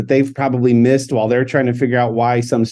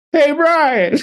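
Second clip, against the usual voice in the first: high call-out; 14 semitones higher than usual. Retold or imitated. Hailing Brian in the airport terminal, high call-out. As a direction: reenact hailing a stranger, voice pitched up.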